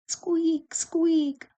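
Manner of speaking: very high squeaky lantern noise; high-pitched creak on each word; squeaking lantern. A narrator's reading. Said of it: "went the lanterns".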